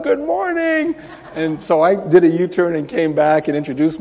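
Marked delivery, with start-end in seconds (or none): 0.0-1.0 high, cheery imitated call; yelled greeting. Woman yelling back from sliding door, imitated call. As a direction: imitate her calling back high and long. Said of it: "she yelled out".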